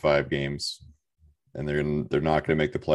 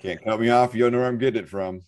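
normal talking voice

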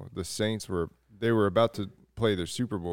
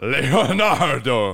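slow, loud drawn-out Italian cry; hammed-up Italian call; mock Italian call; 5 semitones higher, 9 dB louder, much slower than usual. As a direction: stretch the name out operatically. Joining the Ezio joke.

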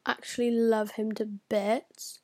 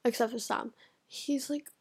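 usual speaking voice

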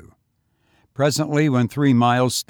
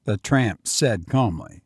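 usual speaking voice

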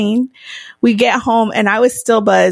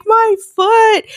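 mock sob-voiced whine, higher; imitating crying friend; 10 semitones higher, 3 dB louder than usual. Retelling injured friend crying over her foot, mock whimper. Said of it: "starts crying".

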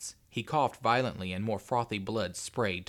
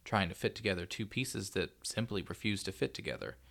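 usual speaking voice

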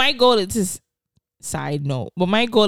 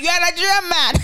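excited; 8 semitones higher, 4 dB louder than usual. Retold or imitated. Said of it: "I'm like".

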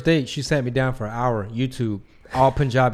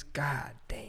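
breathy awed whisper; whispered god damn; 12 dB quieter than usual. Real, not acted. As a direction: whisper it in awe. Savoring fried cauliflower.

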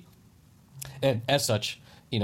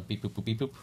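mouthed keypad beeps; touch tone beeps; 6 dB quieter than usual. Pretend. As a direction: beep boop like dialing buttons. Imitating phone keypad while searching.

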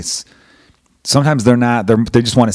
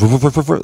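quiet-to-loud dog barking; 4 semitones higher than usual. Retold or imitated. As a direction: mimic a dog starting up again. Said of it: "then it gets real quiet".

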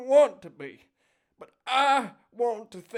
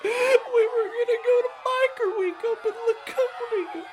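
panicked wailing; 12 semitones higher, 9 dB louder than usual. Acted. Fleeing, wailing over Daryl.

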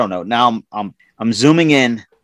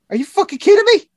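high, raised disbelief; retold outrage; 4 dB louder, faster than usual. Retelling his outburst at the foreman, high and indignant. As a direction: squeal it out in mock fury. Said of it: "I go".

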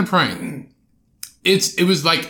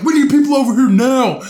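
imitating protesters, higher and louder; mock panicked protester; 9 semitones higher, 7 dB louder than usual. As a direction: fake alarm calling for backup. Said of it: "he's like".

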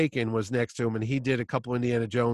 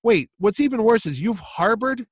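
incredulous Chaykin; 9 semitones higher, 3 dB louder than usual. Retold or imitated. Chaykin lecturing about wasted hatred.

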